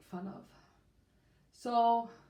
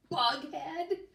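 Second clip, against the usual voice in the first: a grinning, teasing question; 7 semitones higher, 3 dB louder than usual. A narrator's reading. Lee grinning as he teases about the new neighbor, amused. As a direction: say it through a grin, amused. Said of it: "grinned Lee".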